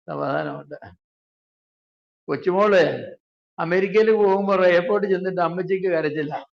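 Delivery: normal talking voice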